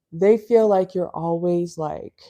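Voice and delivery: normal talking voice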